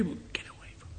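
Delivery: barely voiced whispered refusal; retold whisper. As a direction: breathe it out weakly. Dying man keeps rejecting him.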